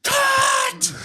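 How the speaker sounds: high, sharp yell of cut; imitating a director's sudden yell; shouted cut. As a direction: yell it from the dark. Director yelling cut in story.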